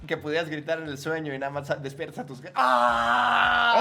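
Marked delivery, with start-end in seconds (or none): 2.6-3.8 sudden loud, high scream; a hammed-up scream that would wake the whole house; mock nightmare scream. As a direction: let out a startled yell as if waking from a nightmare. Acting out waking family screaming.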